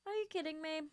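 can't believe it; excited disbelief. Real, not acted. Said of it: "repeats it higher".

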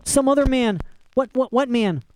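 high, agitated disbelief; panicked questioning. A narrator's reading. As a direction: stammer out high, frantic questions. Condemned flyer questions emperor in panic.

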